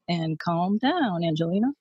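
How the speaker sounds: drawn out and low, teasingly calm; imitated calm. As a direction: imitate someone calming an over-excited friend. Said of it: "then they become even calmer".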